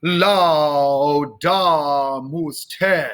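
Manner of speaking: forte "Laudamus te", strong L and stretched vowels; slow choral chant launched on a big L; big L sound. Shown as a demonstration. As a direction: lean into the L of Laudamus, slow and sustained. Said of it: "Big L sound".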